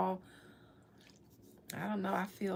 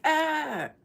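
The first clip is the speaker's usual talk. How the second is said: mock grunt; 6 semitones higher, 8 dB louder than usual. Imitating Stallone's reaction.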